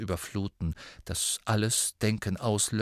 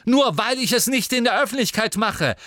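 higher, louder protest; angry defense; 12 semitones higher, 7 dB louder than usual. Acted. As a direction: shout your excuse. Ron defends himself angrily.

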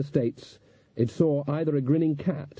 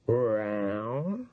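cat-man growl; 4 dB quieter than usual. A narrator's reading. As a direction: growl it like a tomcat turned human. Cat-man frightens the coachman.

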